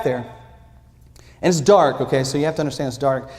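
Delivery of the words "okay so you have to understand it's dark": ordinary talk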